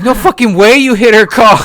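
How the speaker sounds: loud, high disbelieving retort; imitating mom's loud disbelief; loud incredulous. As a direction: throw it back in pure disbelief. Mother's disbelief retold.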